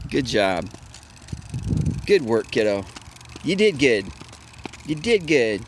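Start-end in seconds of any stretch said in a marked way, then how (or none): none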